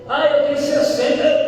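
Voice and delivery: usual speaking voice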